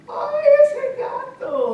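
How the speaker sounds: very high, loud, slow cry; squealed fright. Acted. Little girl coming home from the cat, squealed fright. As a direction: squeal it long in acted fright.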